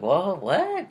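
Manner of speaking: surprised whoa. Retold or imitated. Learns of more family.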